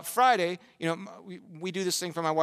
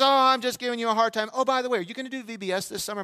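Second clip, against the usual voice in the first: higher, breezy mock female voice; imitated woman; 5 semitones higher, faster than usual. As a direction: play the mother brushing it off, voice lifted. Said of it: "And she says".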